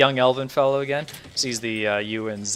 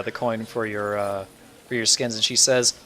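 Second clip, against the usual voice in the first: usual speaking voice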